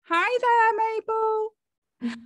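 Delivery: high singsong hello to a pet; high, doting pet-greeting voice; greeting a dog. Real, not acted. Host greets a dog on camera.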